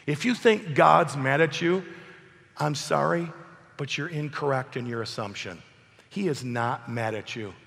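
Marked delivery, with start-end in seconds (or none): none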